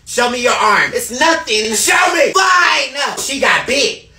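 loud frantic yelling throughout; screaming frantic argument over a gun; panicked shouting. Acted. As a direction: yell in a panic over the bite. Panicked zombie-bite scene, shouting.

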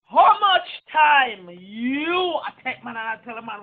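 slow, loud, accusing; angry loud accusation. Real, not acted. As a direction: hurl the question at her.

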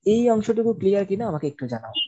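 ordinary talk, nothing marked

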